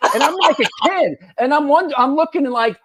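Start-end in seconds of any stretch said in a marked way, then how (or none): none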